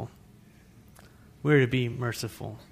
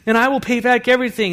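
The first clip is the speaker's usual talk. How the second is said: higher, pleading servant's voice; begging servant; 10 semitones higher, 7 dB louder, faster than usual. A narrator's reading. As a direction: beg the master for time. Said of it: "he begged".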